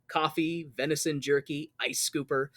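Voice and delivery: ordinary delivery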